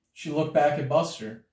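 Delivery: usual speaking voice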